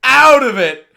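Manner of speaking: loud, high, dragged-out yelling; stunned shout. Real, not acted. Vlogger shouting at a drunk man's ring walk in disbelief. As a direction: bellow in amazement at the screen. Watching drunk walkout on video.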